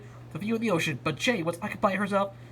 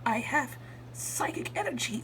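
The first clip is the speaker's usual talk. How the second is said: secretive whisper; 5 semitones higher, 6 dB quieter than usual. A narrator's reading. September to the newcomers, a secretive whisper. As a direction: let it out as a hushed secret.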